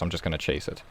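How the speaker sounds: usual speaking voice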